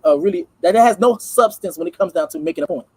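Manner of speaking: ordinary talk, nothing marked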